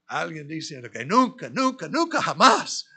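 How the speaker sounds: rising to a high, loud cry of nunca jamás; building exclamation. Retold or imitated. Preacher recalling the teacher's verdict, building to a high loud cry.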